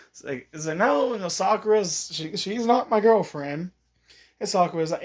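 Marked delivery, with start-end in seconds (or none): 0.8-3.7 high, flustered denial. Retold or imitated. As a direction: deny it in a panic.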